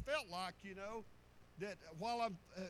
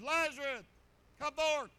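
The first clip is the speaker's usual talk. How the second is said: loud, higher ringing command; commanding call; 5 semitones higher, 10 dB louder than usual. Acted. Jesus calling Lazarus out of the tomb, loud command. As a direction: call him out of the grave with authority.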